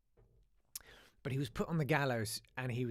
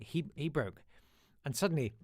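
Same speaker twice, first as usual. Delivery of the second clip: usual speaking voice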